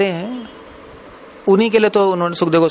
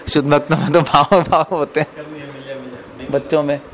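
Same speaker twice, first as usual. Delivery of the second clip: amused, laughing through the words. Real, not acted. Teacher joking to his class about devotees, laughing. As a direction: let a chuckle run into the line.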